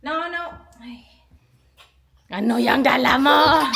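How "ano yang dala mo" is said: loud, slow, drawn-out question; calling out. Real, not acted. Catching child carrying something. (malakas at pahabang pagsita)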